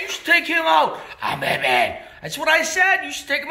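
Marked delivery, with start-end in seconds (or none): 1.2-2.0 low, terse Batman growl; gruff Batman. Acted. Batman answering the announcer with his catchphrase. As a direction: answer deep and flat like Batman.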